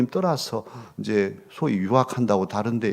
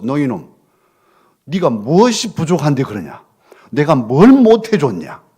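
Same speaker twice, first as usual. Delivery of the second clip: loud, angry parental yell; harsh scolding; 9 dB louder than usual. Retold or imitated. Parent berating a hurting teen, loud angry scolding. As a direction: scold the child like an outraged father. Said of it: "이렇게 하고 막 윽박 지르니".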